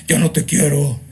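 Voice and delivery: mock the kid turned demon; possessed teen. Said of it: "luego crecen". (imitación de adolescente endemoniado)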